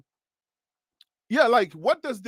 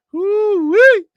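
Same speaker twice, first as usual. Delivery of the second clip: high, loud "woo-wee"; excited whoop; 14 semitones higher, 9 dB louder than usual. Real, not acted. Exclaims while thanking viewers.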